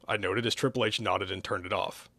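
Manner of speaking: plain reading voice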